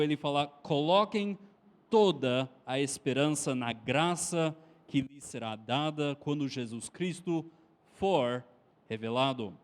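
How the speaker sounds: normal talking voice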